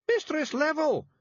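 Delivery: very high, loud Scots yell, drawn out; high, loud Feegle yell. A narrator's reading. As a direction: yell her name desperately. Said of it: "Rob Anybody yelled".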